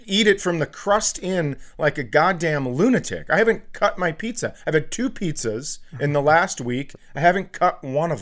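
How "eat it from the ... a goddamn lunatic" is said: ordinary delivery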